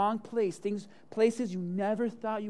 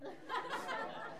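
high squealing laugh; 13 dB quieter than usual. Real, not acted. Big laugh at own story.

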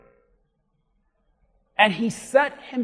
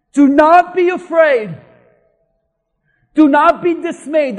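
loud, raised proclamation of scripture; forceful preaching; 5 semitones higher, 11 dB louder than usual. Acted. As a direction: proclaim it like a divine command. Preaches God's command forcefully.

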